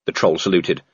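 ordinary delivery, nothing marked

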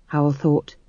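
ordinary delivery

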